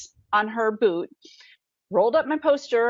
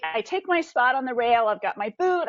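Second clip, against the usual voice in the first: usual speaking voice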